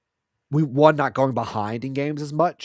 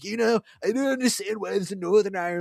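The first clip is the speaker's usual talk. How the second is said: higher put-on voice, puzzled; mock politician; 8 semitones higher than usual. Imagining a politician visiting Ireland, higher put-on voice. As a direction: play the clueless visiting leader. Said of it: "would go to Ireland and be like".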